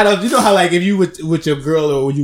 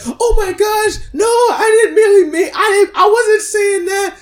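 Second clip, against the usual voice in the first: much higher, shrieking, tearful protest; mock panicked clerk; 5 dB louder, slower than usual. The clerk denying to the angry mom, shrill crying panic. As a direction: wail the denial in panic. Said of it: "the lady's like".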